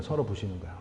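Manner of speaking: trailing off. Acted. Preacher fading repetition.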